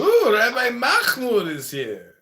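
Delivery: high, loud, drawn-out mock welcome; mock excited announcement. Roaster heralds another guest's arrival, mock excited. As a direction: announce him with hammed-up excitement, stretching it out.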